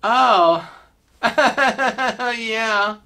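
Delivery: halting "oh", then awkward laughing yeah; pause, then laugh it off sheepishly; sheepish realization. Acted. Guy caught out by his old hairdresser, sheepish. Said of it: "guy kinda just stood there".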